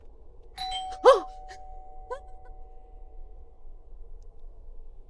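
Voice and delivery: sharp, high, loud gasp; startled gasp. Real, not acted. Player hit by a jump scare, sharp startled gasp. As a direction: gasp suddenly in fright.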